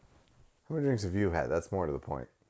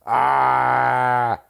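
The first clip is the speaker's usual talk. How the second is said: Paul Gleason impression; 3 semitones higher, 4 dB louder, much slower than usual. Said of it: "He's like".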